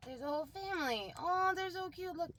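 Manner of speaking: high, excited cooing; cooing delight. Real, not acted. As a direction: gush over the cute little family. Cooing over toy animals.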